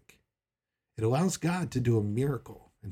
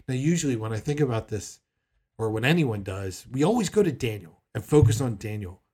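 normal talking voice

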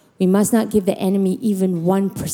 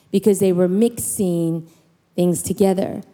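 ordinary talk, nothing marked

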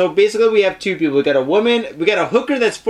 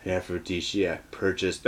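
deep voice; 14 semitones lower, 13 dB quieter than usual. Shown as a demonstration. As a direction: drop the voice down deep. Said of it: "maybe I should do this in a deep voice".

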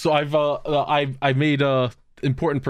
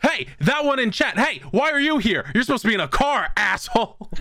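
high-pitched mock calling out; high mock yell; 9 semitones higher than usual. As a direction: call out to him, pitched up. Shouting at chat image.

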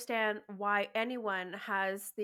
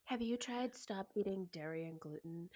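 mock advice giver; 3 semitones lower, 6 dB quieter than usual. Mocking skin advice givers.

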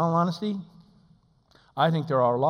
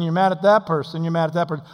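usual speaking voice

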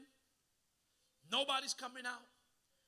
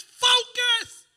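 shout; 13 semitones higher, 21 dB louder than usual. Acted. God's command voiced.

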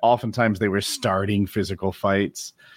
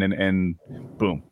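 ordinary delivery

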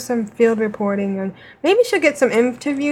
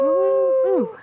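cheering; 13 semitones higher than usual. Real, not acted. Cheering a friend's news.

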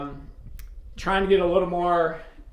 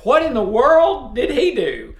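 mock surprise; 6 semitones higher, 4 dB louder than usual. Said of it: "And Andy was like".

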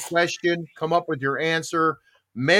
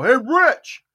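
call across room; 9 semitones higher, 7 dB louder than usual. Retold or imitated. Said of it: "I yelled to Richard".

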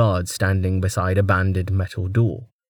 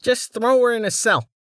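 higher, reedy order; commanding noble; 14 semitones higher than usual. Acted. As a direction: give a curt order. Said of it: "he commanded".

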